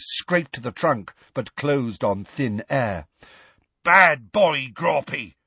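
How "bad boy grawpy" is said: loud, higher scolding; Hagrid yelling. A narrator's reading. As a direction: scold a giant like a naughty pet. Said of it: "they heard Hagrid yelling".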